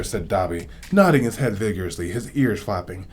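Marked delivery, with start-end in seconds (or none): none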